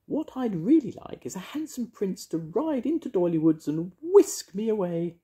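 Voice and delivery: high-pitched wishful fairy voice; yearning, high little fairy; dreamy fairy. A narrator's reading. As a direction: pour out the wish in a tiny piping voice. Voices Mavis the fairy wishing.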